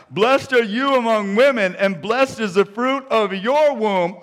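loud cry. A narrator's reading. Elizabeth greeting Mary with a blessing, loud cry. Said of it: "she exclaimed with a loud cry".

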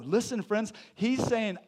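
usual speaking voice

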